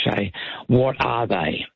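ordinary talk, nothing marked

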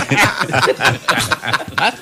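big fake sarcastic laugh. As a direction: laugh mockingly, putting it on. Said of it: "empezó a reír sarcásticamente".

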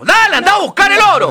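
high, loud push; greedy shout. Acted. Mother sends her lazy daughter down the well for gold, high and loud.

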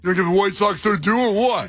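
mock the texter with an annoying tone; hammed-up needling fan. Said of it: "I'm just getting a tone from him".

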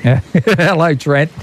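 high, laughing hello to a mate; laughing greeting. Real, not acted. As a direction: greet him through a chuckle.

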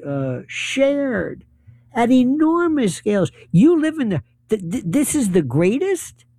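normal talking voice

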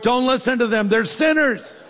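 high-pitched comic pleading; hammed-up panicky objection; mock desperate protest. Acts Paul protesting to the Lord.

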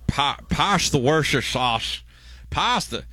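a lightly done Scottish Connery lisp; Sean Connery impression. Host tries a mock Connery for listeners. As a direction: slur the s sounds like a hammed-up Connery. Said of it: "the worst Connery I've ever heard".

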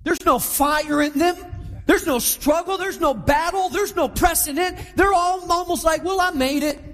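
high-pitched, driving sermon delivery; impassioned preaching. Real, not acted. Pastor exhorting the congregation about spiritual pride, impassioned. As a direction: preach it hard, piling up each phrase.

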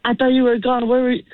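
high flustered babble; a caught-out son, flustered and high. Retold or imitated. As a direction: blurt it out caught off guard. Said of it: "I'm, like, so flustered".

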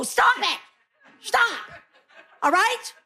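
high-pitched, cut-short scolding; acted parental outburst in a high voice; exasperated mom. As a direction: snap at squabbling kids, pitch jumping up. Mom snapping at fighting kids.

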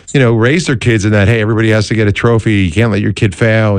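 ordinary delivery

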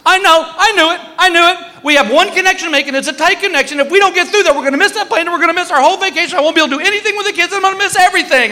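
shrill-free but very high frantic rant; panicking wife. Retold or imitated. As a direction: play the wife spiraling into panic. Wife's panicked rant imitated.

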